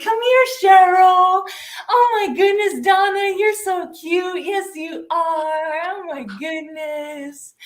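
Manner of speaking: put-on high, drawn-out gushing over a baby; cooing baby talk. An adult fussing over a newborn with an office-worker name, cooing baby talk. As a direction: coo sing-song, loud at first, then softening.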